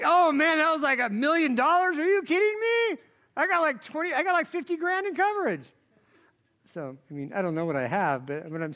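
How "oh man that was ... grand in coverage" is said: high, loud, rattled yelp at a costly crash; panicked outburst. Pretend. Driver panicking after hitting a Lamborghini, high loud alarm. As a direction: panic over the bill.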